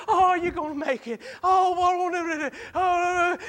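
high, tearful voice of a frightened wife; worried wife. Retold or imitated. Wife panicking over her feverish husband, high and tearful. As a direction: cry out like a scared wife at the bedside. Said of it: "My wife, bless her heart".

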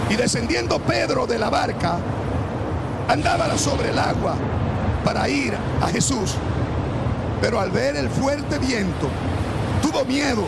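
loud, high, fervent Bible reading; preaching at full force. Acted. Preacher reads to the church, loud and high-pitched. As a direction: preach the storm story to the congregation.

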